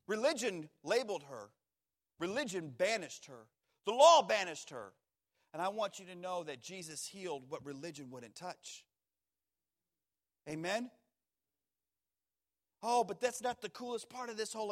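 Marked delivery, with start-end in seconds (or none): none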